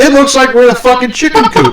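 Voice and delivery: very high, squawking complaint; hammed-up high-pitched indignation; mock outrage. Mock outrage at the steel cage.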